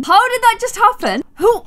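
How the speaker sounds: high, loud disbelief; shocked. Real, not acted. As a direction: shout it in disbelief. Second, louder, higher take.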